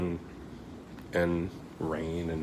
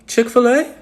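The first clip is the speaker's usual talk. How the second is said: excited; 14 dB louder than usual. Real, not acted. Excited about chicken sandwich.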